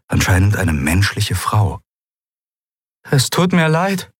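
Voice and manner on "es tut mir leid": apologetic. Acted. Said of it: "hörte Endriel sie sagen".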